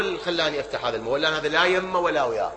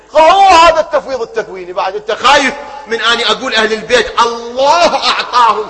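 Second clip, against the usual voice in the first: preaching at full force; 5 semitones higher, 9 dB louder than usual. Acted. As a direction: hammer each point to the crowd. (وعظ بصوت عالٍ وحماسي)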